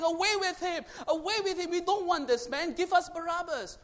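high, loud crowd yell; crowd shouting. Retold or imitated. The crowd yelling to Pilate, a high loud shout. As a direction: shout it like a mob. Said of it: "The whole crowd was shouting".

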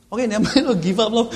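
laughing, loud slip of the tongue; wrong words. Retold or imitated. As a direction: blurt the mix-up, laughing. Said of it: "it came out the wrong way".

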